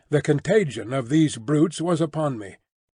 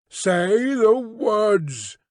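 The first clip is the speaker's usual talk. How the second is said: very slow, higher, commanding beast voice; louder command; 6 semitones higher, much slower than usual. Acted. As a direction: drag out the order with menace. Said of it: "repeated in a louder tone".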